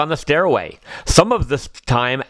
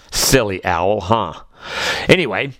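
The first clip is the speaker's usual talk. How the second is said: ordinary delivery, nothing marked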